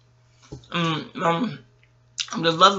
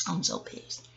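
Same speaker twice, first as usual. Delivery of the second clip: breathless whisper of annoyance; whispered aside, barely voiced; 13 dB quieter, slower than usual. Real, not acted. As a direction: hiss it under your breath. Repeats annoyed phrase.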